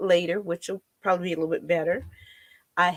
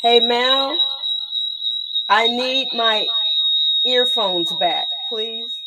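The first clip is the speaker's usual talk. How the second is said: calling out; 4 semitones higher, 4 dB louder, slower than usual. Real, not acted. Calling to helper off-mic.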